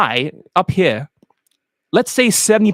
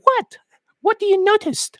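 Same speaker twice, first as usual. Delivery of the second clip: high falsetto mock woman; curious woman. Woman asking what he noticed, high mock falsetto. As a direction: ask it in a piping falsetto.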